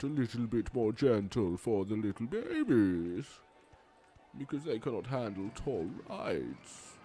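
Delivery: put-on cooing condescension; mock-babying, sing-song sneer; mocking baby talk. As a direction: coddle them with sarcastic babyish sweetness. Mocking timid guests as babies.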